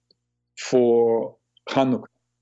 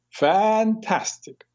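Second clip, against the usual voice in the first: put-on slow, drawn-out Italian-accented fantastic; 9 semitones higher, much slower than usual. Hairdresser Sal answering his client, slow Italian-accented fantastic. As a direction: stretch it like an Italian hairdresser, higher pitch. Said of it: "with an Italian accent".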